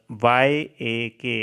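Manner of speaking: normal talking voice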